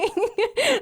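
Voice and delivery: short laugh, very high; a quick yelp of a laugh; real short laugh up high. Real, not acted. Laughing at bear memory.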